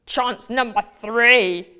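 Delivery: slow, loud wizard line; a hint of a nasty wizard. Wizard speaking in story.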